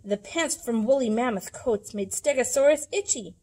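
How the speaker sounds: usual speaking voice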